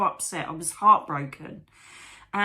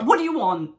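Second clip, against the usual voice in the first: loud, higher, irritated snap back; raised-voice, stroppy demand; retold shitty retort; 5 semitones higher, 8 dB louder than usual. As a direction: re-enact an exasperated comeback. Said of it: "really shitty".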